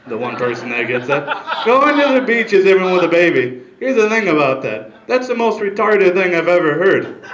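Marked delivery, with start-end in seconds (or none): 1.6-3.6 louder, higher dim mother voice; mimicking the mother's dumb remark, loud and high; mocking the mother. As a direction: parrot her line in a loud dopey mock voice. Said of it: "the mother said out loud".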